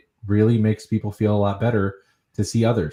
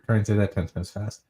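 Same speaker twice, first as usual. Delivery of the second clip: normal talking voice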